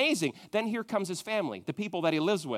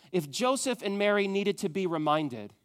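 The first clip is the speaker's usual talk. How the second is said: ordinary talk, nothing marked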